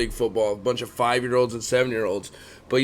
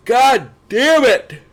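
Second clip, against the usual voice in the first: loud, high, drawn-out curse; real frustrated yell, stretched slow; exasperated cursing; 10 semitones higher, 8 dB louder, much slower than usual. Real, not acted. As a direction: curse your own yawn in frustration. Annoyed at yawning on camera.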